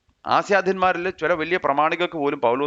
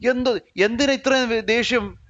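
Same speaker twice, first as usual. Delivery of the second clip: exasperated why; 8 semitones higher than usual. Acted. Exasperated question at angry opponents.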